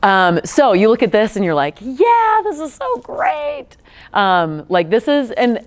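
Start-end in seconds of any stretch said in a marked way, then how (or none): 1.8-3.7 very high, slow falsetto gush; squeaky mock enthusiasm, much higher. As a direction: ham up a starry-eyed admirer. Mocking outsiders' admiring reaction.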